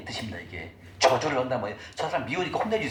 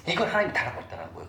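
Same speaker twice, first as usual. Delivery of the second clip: normal talking voice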